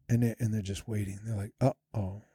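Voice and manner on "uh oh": small, low, much softer; mock dread, almost under the breath; worried uh-oh. Imitates worried staff awaiting complaint.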